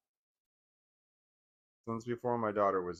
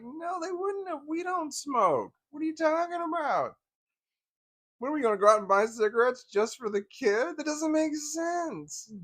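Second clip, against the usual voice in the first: much higher, squeaky exasperated pleading; retold husband protesting, much higher and exasperated; 3 dB louder than usual. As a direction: throw up your hands in disbelief. Retelling argument with his wife.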